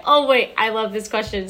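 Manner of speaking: ordinary talk, nothing marked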